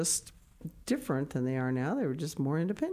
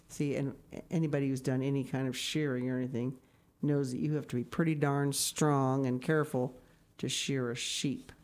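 usual speaking voice

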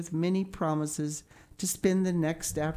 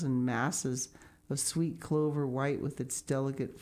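ordinary delivery

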